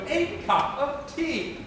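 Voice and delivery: slow A CUP OF TEA, A as "ay"; corrective pronunciation. Shown as a demonstration. Professor corrects his pupil, slow distinct words. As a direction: separate words, clear EE in "tea". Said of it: "No, no".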